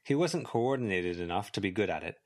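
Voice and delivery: ordinary delivery, nothing marked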